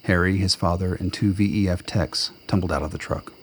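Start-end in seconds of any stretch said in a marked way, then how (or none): none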